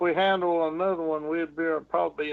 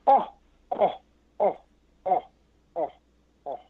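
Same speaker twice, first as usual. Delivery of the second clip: Bigfoot laugh; 3 semitones higher than usual. Shown as a demonstration. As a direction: chant each "oh" like a laughing beast. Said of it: "I'm doing the best imitation I can".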